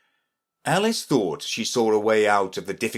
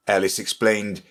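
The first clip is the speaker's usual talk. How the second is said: usual speaking voice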